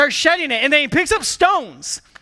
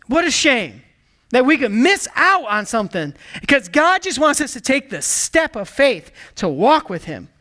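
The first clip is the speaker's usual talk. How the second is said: normal talking voice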